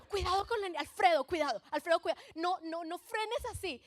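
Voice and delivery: high, loud alarmed warnings; new mother yelling at her husband to drive carefully; panicked backseat yelling. Retold or imitated. Wife yelling at driving husband.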